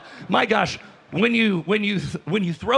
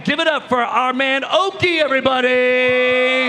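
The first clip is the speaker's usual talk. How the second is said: hype announcer introduction; 3 semitones higher, 3 dB louder than usual. Real, not acted. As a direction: whip up the crowd for the guest. Hype host introduces a guest.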